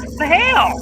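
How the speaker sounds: high, loud "what the hell"; shocked outburst at the numbers. Real, not acted. Surprised at viewer count.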